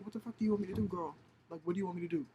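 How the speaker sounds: high-pitched acted exasperation; exasperated. Exasperated retort to stuck friend.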